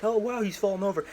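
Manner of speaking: a lightly voiced boss, faking shock; mafia boss. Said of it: "the big mafia dad boss is like".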